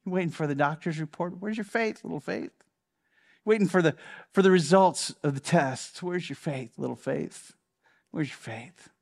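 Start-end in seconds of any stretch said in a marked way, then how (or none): 1.4-2.5 hushed, intimate question; soft chiding. Retold or imitated. Jesus to someone awaiting the doctor's report, chiding softly. 6.0-8.9 low, hushed repeated question; soft chiding. Retold or imitated. Jesus to someone awaiting test results, chiding softly.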